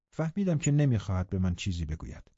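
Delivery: ordinary delivery, nothing marked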